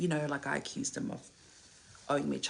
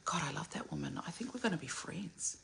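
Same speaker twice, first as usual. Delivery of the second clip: usual speaking voice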